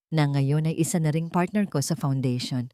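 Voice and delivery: usual speaking voice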